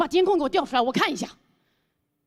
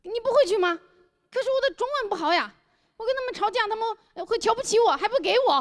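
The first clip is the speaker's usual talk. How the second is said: high pleading foreigner voice; whiny foreigner in broken Mandarin; foreigner speaking mandarin; 9 semitones higher than usual. Retold or imitated.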